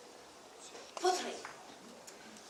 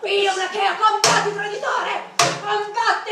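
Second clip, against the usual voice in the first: loud, high war cry; mock battle cry; 4 semitones higher, 9 dB louder than usual. Playing Achaean against the guard, loud battle shout. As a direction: call him traitor and fight.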